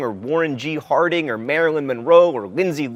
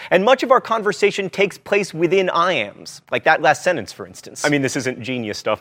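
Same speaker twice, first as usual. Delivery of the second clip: usual speaking voice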